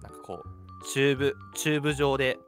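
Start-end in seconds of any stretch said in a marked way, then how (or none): none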